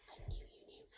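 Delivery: secret whisper. Real, not acted. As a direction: whisper it close. Whispered praise.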